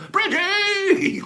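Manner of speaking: "Bridgie!" yelled very high and long; yelled name. Shown as a demonstration. Host showing how everyone calls the name, high drawn-out yell. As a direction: belt "Bridgie!" like a crowd cheer. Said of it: "people now going".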